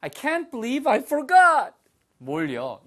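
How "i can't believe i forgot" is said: high, loud, drawn-out dismay; exasperated disbelief. Acted. Teacher models forgetting for students, loudly aghast. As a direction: cry it out slowly in disbelief. Full emotional model of the sentence.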